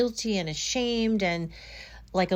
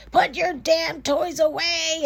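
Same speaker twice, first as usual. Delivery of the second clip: higher, mildly raised scolding yell; mock scream; 8 semitones higher, 4 dB louder than usual. Triggered mother to her kids, mock screaming. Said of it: "now she's screaming at the kids".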